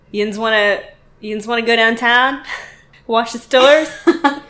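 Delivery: broad Pittsburgh accent; Pittsburghese. Shown as a demonstration. Showing cohost how Pittsburgh people talk, Pittsburgh accent. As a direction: lapse into Pittsburghese, questions with a laugh. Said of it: "lapse back into some Pittsburghese".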